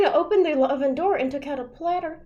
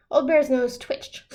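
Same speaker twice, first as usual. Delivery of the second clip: usual speaking voice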